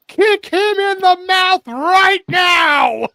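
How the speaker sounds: high, slow, drawn-out scream; Tony Schiavone scream. Retold or imitated. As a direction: yell it like a frantic announcer. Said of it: "And Tony Schiavone screams".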